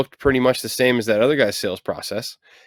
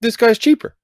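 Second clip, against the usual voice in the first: mock client; 9 semitones higher than usual. Contractor mimics a client choosing the cheap bid, high and smug. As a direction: sell it like a bargain hunter. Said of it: "so they'll go".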